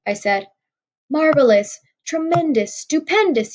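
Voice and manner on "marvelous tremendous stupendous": put-on over-the-top cheer for a child; overblown praise shout. A narrator's reading. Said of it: "he shouted".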